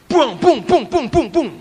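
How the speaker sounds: high-pitched rapid imitated thumps; energetic "bung bung" noises. Preacher imitates Simon's hectic energy, punchy sound effects. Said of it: "Orangnya itu energik sekali".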